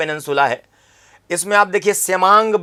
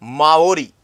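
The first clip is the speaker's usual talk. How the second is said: "Maori" said slowly and clearly, falling; name repeated for notes; 3 semitones lower, much slower than usual. Shown as a demonstration. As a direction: repeat "Maori" so students can write it. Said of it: "जनजाति है Maori". ("Maori" साफ़ और धीमे बोलें)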